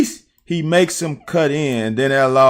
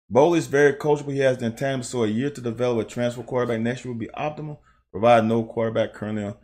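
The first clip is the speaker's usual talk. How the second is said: reading a comment; 3 semitones lower, 7 dB quieter, faster than usual. Real, not acted. Reading a chat comment aloud.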